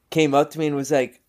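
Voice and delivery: ordinary delivery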